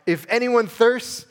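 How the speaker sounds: high, loud, slow proclamation; crying out. Retold or imitated. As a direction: call it out to the whole crowd, stretched. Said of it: "Jesus stood up and cried out".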